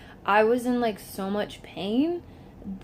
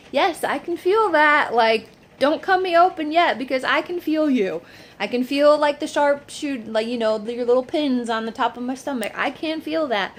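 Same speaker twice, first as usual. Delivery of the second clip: urgent insistence; 5 semitones higher, 7 dB louder than usual. Retold or imitated. Retold urgent plea to surgeons.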